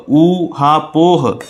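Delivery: ऊहापोह drawn out very slowly as ऊ, हा, पोह; syllable by syllable. Shown as a demonstration. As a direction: break ऊहापोह into long separate parts. Teacher breaks word into syllables.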